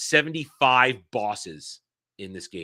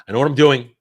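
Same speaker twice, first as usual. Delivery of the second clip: louder, defiant insistence; louder mock-confident claim; loud self-assurance; 11 dB louder than usual. Real, not acted. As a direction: insist loudly to chat. Fumbling the stream audio.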